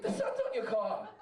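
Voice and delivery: hammed-up repeat to a rolled-down window; insistent repeat yell. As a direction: shout it again, exasperated he didn't hear. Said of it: "It's like".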